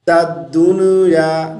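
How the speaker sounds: दुनिया said as du-nu-ya; wrong bounced noon. Shown as a demonstration. Teacher shows the mistake, दुनिया with bouncing n. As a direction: bounce the n in दुनिया into an extra vowel. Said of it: "नून पर कलकला नहीं करेंगे".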